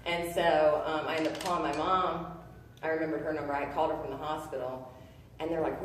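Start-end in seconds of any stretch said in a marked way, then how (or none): none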